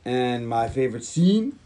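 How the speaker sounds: "scene" drawn out with a rising end; odd questioning lilt. Real, not acted. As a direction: stretch "scene" and swing it upward like a question. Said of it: "why I said it like that".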